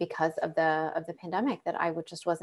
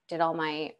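ordinary delivery

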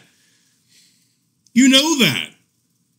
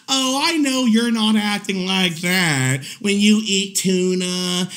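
slow, drawn-out mock cooing; singsong parody of scolding a pet; imitating playing it off. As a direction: stretch the words out, mocking her cover-up. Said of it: "she tried to play it off".

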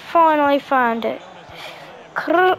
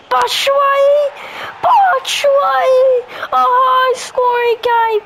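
excited shouting; 7 semitones higher than usual. Real, not acted. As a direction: cheer the goal at full voice. Goal celebration while gaming.

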